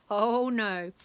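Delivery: ordinary delivery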